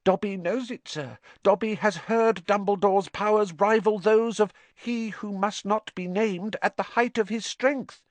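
high squeaky elf voice; eager, high little elf pleading; house-elf voice. Acted. Dobby the house-elf speaks.

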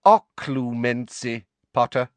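slow, high sneering enunciation; pronounced sneer. A narrator's reading. Snape repeating the subject to Harry, sneering. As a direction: spell out the term slowly with disdain. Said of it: "Snape's sneer became more pronounced".

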